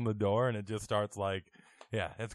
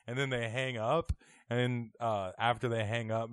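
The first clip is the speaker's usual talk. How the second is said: ordinary delivery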